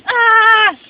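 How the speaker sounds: sudden loud high yell as the jellyfish strikes; attack cry. Retold or imitated. Jellyfish lunging at the sheep, a loud high yell. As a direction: let out a piercing attack shout.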